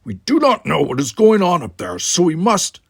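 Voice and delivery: commanding. Acted. Worf takes command.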